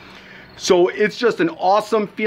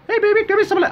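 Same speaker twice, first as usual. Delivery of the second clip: imitating the wife; faster than usual. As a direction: squeak it out as a coy wife teasing her husband. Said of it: "test it out again".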